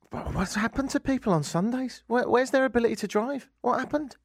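comic exasperation, voice lifted; exasperated disbelief. Real, not acted. Ranting about Sunday drivers.

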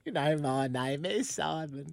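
slow, exaggerated British introduction; British accent. Shown as a demonstration. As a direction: draw out a mock British accent. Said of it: "just back to British again".

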